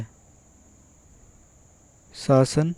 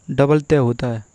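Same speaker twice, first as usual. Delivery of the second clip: ordinary talk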